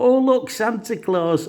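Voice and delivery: put-on high, thrilled little-kid exclamation; high-pitched mock wonder; delighted child. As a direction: mimic a kid's slow, high-pitched delight. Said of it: "he was so happy".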